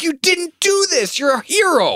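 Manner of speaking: squeaky incredulous lawyer impression; exasperated lawyer. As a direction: do the fed-up lawyer way up high. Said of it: "He's like".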